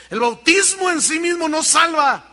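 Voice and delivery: high, loud pulpit declaration; emphatic preaching shout. Real, not acted. Preacher refuting baptism as salvation, real emphatic shout. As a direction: thunder it out to settle the argument.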